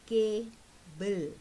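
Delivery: "Cable" in two beats, CA, pause, BLE; split into syllables. Shown as a demonstration. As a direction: break "Cable" after the first syllable. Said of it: "two syllables again in cable".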